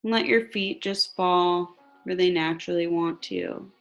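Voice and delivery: slow, calm meditation voice; guided meditation. Acted. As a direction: guide them gently into relaxation.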